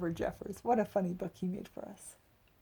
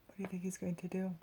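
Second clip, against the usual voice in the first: whisper; 5 dB quieter, faster than usual. Real, not acted. Aside to listening children.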